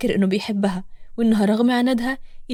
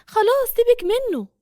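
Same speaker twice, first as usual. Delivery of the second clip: Nour's voice; 11 semitones higher than usual. Acted. Nour calms her friend.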